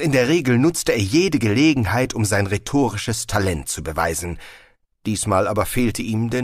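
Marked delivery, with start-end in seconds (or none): none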